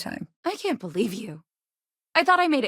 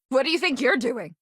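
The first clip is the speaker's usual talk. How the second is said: higher-pitched, affronted demand; indignant challenge; 7 semitones higher than usual. Acted. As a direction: call someone out for misbehaving. Challenging someone caught misbehaving.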